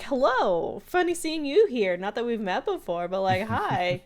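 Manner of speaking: high, loud, eager mock flirting; flirty greeting.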